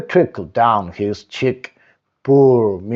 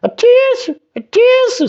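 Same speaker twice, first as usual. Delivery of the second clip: sniffing sneeze. A narrator's reading. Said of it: "sniffed the pig".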